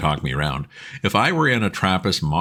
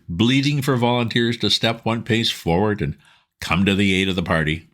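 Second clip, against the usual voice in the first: ordinary delivery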